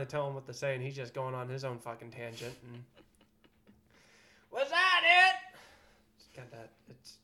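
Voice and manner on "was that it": high, loud, slow squawk; Gilbert Gottfried impression. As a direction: screech the question like Gilbert. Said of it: "typical Gilbert talking".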